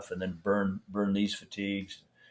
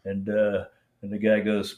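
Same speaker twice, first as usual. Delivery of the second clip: usual speaking voice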